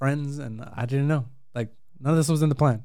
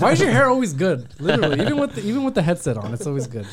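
higher, louder, playfully jealous complaint; mock annoyance; 6 semitones higher, 3 dB louder than usual. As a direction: whine about his perfect hair in jest. Teasing friend about his hair.

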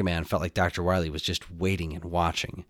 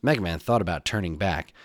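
ordinary delivery

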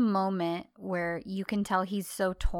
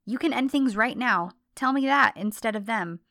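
angry demand; 3 semitones higher, 9 dB louder than usual. Retold or imitated. John daring Sanem to break up now, loud and heated. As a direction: dare her to end it now. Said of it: "He says".

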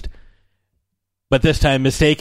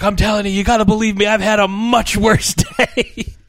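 high, rushed plea stretching out into a laugh; mock desperate insistence; 8 semitones higher, 3 dB louder than usual. Fired employee tells Frank about his day, mock desperate. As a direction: plead your case in a hammed-up high voice.

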